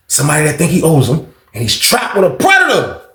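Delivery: ordinary talk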